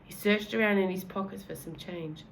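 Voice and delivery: ordinary delivery, nothing marked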